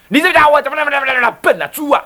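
fast, high mocking scold of a boss; impatient boss calling him a pig. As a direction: rattle off the insult like a fed-up boss. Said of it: "主任一骂人".

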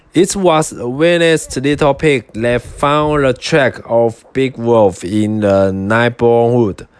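usual speaking voice

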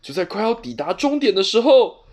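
ordinary delivery, nothing marked

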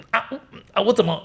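high startled "ah, ah", mimicking a woman caught out; a retold gasp of surprise, voice jumping up. Wife blurts out in shock after giving herself away, startled high gasp.

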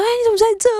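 high, loud retold cry of surprise; startled outburst in a retold scene; startled exclamation. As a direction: blurt it out high and loud, acting the shock. Startled husband discovers someone there.

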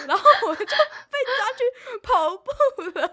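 slow, high, laughing through the words. Real, not acted. Telling her friend, laughing high and loud. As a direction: laugh out the line.

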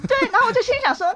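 high, fast, eager retelling of what she thought; excited restart. Real, not acted. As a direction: relaunch the line with a burst of energy.

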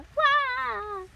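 high, loud cartoon wail; long mock cry on the way down; falling cry. Tumbling into the ditch with a splash, falling cry.

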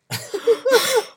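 a high, loud laugh bursting out; shrieking laugh; loud burst of laughter. Real, not acted. Laughs at the story.

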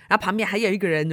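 usual speaking voice

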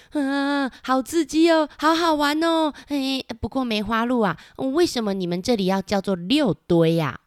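put-on high, thrilled kid voice; excited little character. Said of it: "小黑皮在旁边就说". (兴奋的高音童声)

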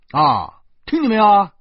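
put-on loud, high check on the child; demanding. Man asks the child if she heard, loudly demanding.

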